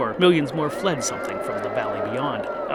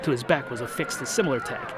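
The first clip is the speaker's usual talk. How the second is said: ordinary delivery, nothing marked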